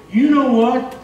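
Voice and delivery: loud, high, emphatic preaching; impassioned preaching. Real, not acted. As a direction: drive it home to the congregation at full force. Preacher exhorting congregation to thankfulness.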